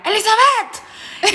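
high, drawn-out yell of a name; imitated shout. Boyfriend yelling at Elizabeth in public, imitated shout. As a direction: call it out like a quarrelling boyfriend. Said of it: "él le gritaba a ella".

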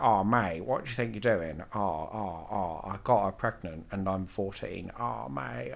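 chav impression. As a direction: mimic a mumbling teenage chav. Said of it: "I think it sounds like a chav".

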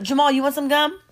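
high, sweetly put-on question; mock-innocent offer. Woman offering her date gum, mock innocent. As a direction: ask it high, all innocence. Acting the planned offer to Jamal.

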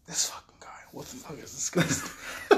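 hushed unvoiced whisper with a suppressed laugh. Friends watching a stranger approach, whispering in alarm. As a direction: whisper it to your friend, nervous.

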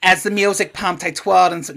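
loud, clumsy Bostonian read; Boston accent. Shown as a demonstration. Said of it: "no, terrible".

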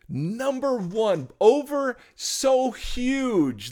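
NUMBER ONE and SO HUGE high and drawn out; slow, high-pitched preacher's emphasis; emphatic preaching. Real, not acted. Preacher driving home main point.

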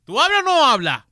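hammed-up shout at a droid; loud, high comic demand; mock yell.

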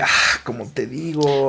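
slow, drawn-out searching; stuck for words. Acted. Asked by a friend what was said, stuck for words. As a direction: drag it out as if hunting for the translation. Acts out groping for a translation.

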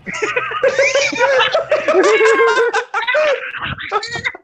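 high shrieking laughter; loud long laugh. Real, not acted.

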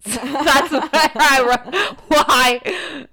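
high, loud words through laughter; helpless laugh while talking. Real, not acted. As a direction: crack up at your own mistake. Laughing at own unfinished sentence.

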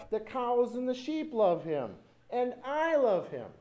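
put-on high, eager answer of pageant kids; shouted response. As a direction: imitate children calling back. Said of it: "the other side would respond".

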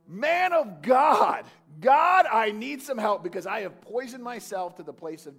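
high, loud desperate plea to God; desperate cry. Acted. Sinner begging God for help, loud desperate cry. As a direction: cry it out like someone at death's door.

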